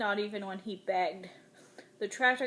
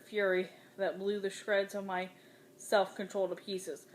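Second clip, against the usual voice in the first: ordinary delivery, nothing marked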